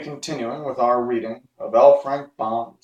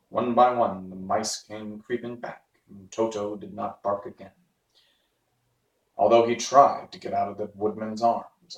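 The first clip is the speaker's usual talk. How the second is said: ordinary delivery, nothing marked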